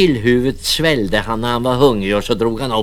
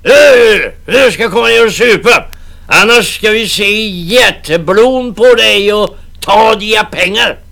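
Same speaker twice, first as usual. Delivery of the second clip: put-on high loud threatening drunk voice; bully him to come down; rowdy navvies; 12 semitones higher, 10 dB louder than usual. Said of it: "skrek de".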